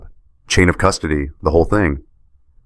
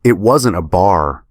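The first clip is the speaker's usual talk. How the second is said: ordinary delivery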